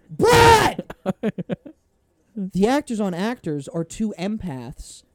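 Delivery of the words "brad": high, loud shout of his name; yelling. Real, not acted. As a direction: yell his name to silence him. Yelling at Brad to stop.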